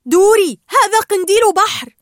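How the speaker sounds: loud, high alarmed warning; gasped alarm. A narrator's reading. Marlin seeing Dory touch a jellyfish, alarmed. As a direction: cry out the name, then warn. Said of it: "شهق مارلين".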